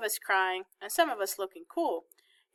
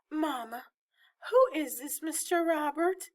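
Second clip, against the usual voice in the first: higher-pitched, mildly anxious question; a touch of worry as she asks; worried; 7 semitones higher than usual. A narrator's reading. Grown daughter asking her mother about a strange man, mildly worried. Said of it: "Mama sounded worried".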